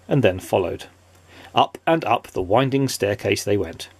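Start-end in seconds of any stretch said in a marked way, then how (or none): none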